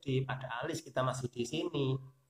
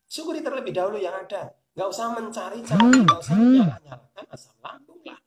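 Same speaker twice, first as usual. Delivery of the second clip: higher, louder impassioned preaching; rising preaching; 8 semitones higher, 9 dB louder than usual. Acted. Urging listeners to be grateful, impassioned preaching. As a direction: drive the point home to the congregation.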